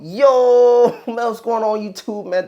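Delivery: loud hype intro greeting; excited shout. Real, not acted. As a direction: burst in loud and hyped.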